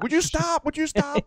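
high, exasperated parent imitation; annoyed parent. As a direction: mimic a fed-up parent, pitched high. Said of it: "they're like".

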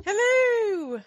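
high, sung-out Canadian hello; Canadian accent. Acted. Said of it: "talk to you in Canadian".

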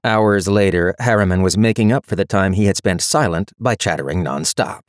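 plain reading voice

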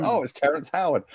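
mock starstruck. Viewer recognising another actor, high mock delight. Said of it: "you're like".

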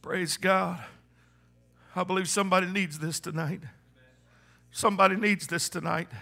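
soft, low, intimate sermon voice over music; hushed preaching. Acted. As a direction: bring it down and speak close to the heart.

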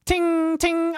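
high mouth-made ting; metal clink effect. Shown as a demonstration. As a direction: voice a blade tapping steel. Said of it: "different sound effects for metal".

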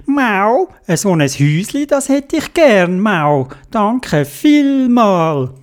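sweet high cat thanks; happy cat voice. Acted. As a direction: purr your thanks. Cat grateful.

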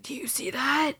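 hushed, spooked whisper; retold frightened whisper. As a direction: whisper like something's out there. Spotting a creepy figure.

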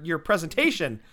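ordinary talk

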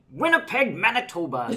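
high-pitched, laughing mock recital; playful catchphrase. Actress quoting the show's running joke, playfully high.

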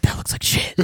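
hushed whisper to a neighbour; whispered movie snark. Retold or imitated. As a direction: lean over and whisper a put-down. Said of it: "that guy wasn't whispering".

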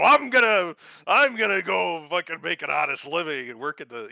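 dad bragging to his daughter about honest work; mock proud dad. Dad brags about honest work, loud mock bluster. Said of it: "this guy's over there go".

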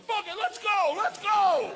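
high, loud rallying cry; fired-up yell. Retold or imitated. Comic gives in to the goading crowd, fired-up holler. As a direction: psych yourself up and holler it.

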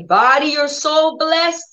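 slow, high, fond blessing; blessing the body. Shown as a demonstration. Preacher showing how to bless one's body, slow and fond. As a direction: bless your body tenderly. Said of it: "That's how you speak to your body".

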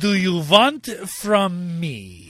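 slow, loud, exaggerated fake Russian accent; mock a clumsy Russian accent, dragging it out; bad Russian accent. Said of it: "the worst Russian accent I've ever heard".

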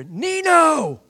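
loud, high holler of a name; calling out. Retold or imitated. Old buddy spotting him on the bench, loud call. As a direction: holler across to a friend.

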